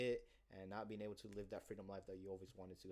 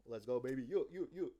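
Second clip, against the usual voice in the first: hype celebration; 3 semitones higher, 6 dB louder than usual. Retold or imitated. Celebrating closing sale.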